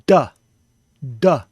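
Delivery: "da" explosivo; plosive d. Shown as a demonstration. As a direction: solte o ar em "da". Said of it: "letting the plosive air come out".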